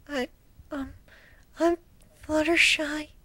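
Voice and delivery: timid pony hiding as she speaks; shy Fluttershy. Acted. Hiding behind her mane to say her name, timid and high. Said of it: "while hiding behind her mane".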